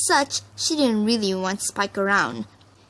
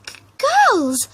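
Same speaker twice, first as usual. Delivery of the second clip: put-on much higher and louder, stumbling into girls; shy pony finally forcing herself to be heard; raised voice; 13 semitones higher, 7 dB louder than usual. A narrator's reading. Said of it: "Fluttershy raised her voice".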